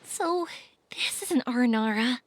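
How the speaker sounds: awed whisper to a shy creature; hushed whisper. Real, not acted. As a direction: whisper it in awe, barely voiced. Awed first sight of creature.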